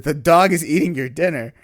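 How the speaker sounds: recounting an incredulous objection; retold insistence. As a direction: spell it out loudly, in disbelief. Retelling his incredulous confrontation.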